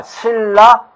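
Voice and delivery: 신라 said slowly as 실라, double L; liquid-assimilation demo. Shown as a demonstration. Teacher shows students liquid assimilation, slowly sounded out. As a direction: stretch 신라 out as 실라. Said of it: "유음화 됐죠".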